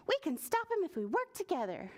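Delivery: high, quick, eager caterpillar; rally a friend in a hurry; fast, high storybook urgency; urgent call. A narrator's reading. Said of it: "called Caterpillar".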